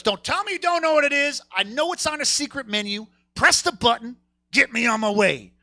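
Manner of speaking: high-pitched, insistent demand; indignant customer. Retold or imitated. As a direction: act out an exasperated customer ordering impatiently. Irate customer demanding secret item.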